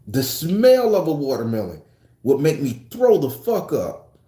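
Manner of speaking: usual speaking voice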